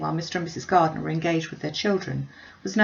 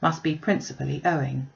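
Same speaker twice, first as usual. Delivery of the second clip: plain reading voice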